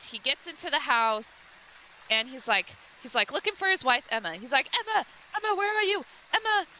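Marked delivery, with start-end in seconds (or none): 4.8-6.6 lightly acted high-pitched call. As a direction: call for a missing wife, pitched up. Husband searching for missing wife.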